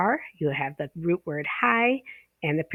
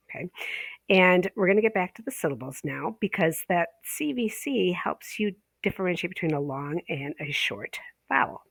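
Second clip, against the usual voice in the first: normal talking voice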